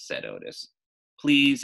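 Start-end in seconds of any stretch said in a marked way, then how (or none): 1.2-1.6 loud, high pleading please; begging. A narrator's reading. Girl begging Otis to come to her party, pleading. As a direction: beg for it with everything. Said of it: "I begged".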